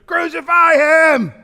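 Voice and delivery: high, loud, slow shout; crowd shouting. Retold or imitated. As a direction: yell it as the angry mob. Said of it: "And they're shouting".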